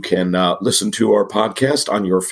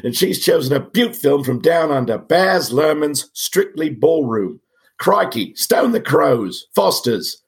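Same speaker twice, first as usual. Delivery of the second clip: lightly put-on Aussie accent, a bit higher; hammed-up mock Australian host; bad Australian accent; 5 semitones higher than usual. Podcast host opening the show in mock Australian accent. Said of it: "now I'll stop with the bad accent".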